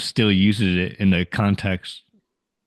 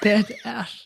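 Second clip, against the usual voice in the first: higher, mock tearful voice; choking back tears; 11 semitones higher, 4 dB quieter than usual. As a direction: pretend to hold in a sob. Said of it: "choke back in tears".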